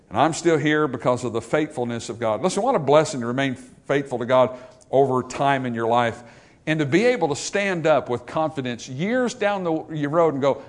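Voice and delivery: normal talking voice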